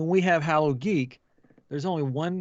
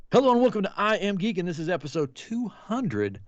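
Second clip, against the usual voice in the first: show host intro; 6 semitones higher than usual. Acted. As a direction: welcome the audience like a show host. Podcast intro read.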